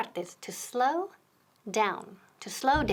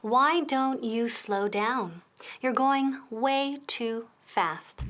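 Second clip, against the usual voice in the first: slow model sentence; much slower than usual. Shown as a demonstration. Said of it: "Let's take a look".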